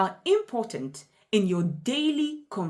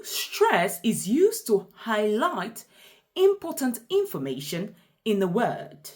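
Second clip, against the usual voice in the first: usual speaking voice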